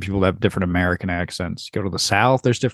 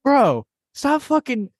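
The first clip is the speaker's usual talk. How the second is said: high-pitched, hammed-up pleading; mock exasperation; 11 semitones higher than usual. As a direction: plead like you're comically fed up, pitched way up. Acted fed-up reply to the Briton.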